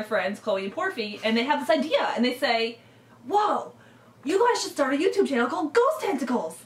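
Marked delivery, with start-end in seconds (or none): 3.3-6.6 excited suggestion. Retold or imitated. Friends suggest a YouTube channel.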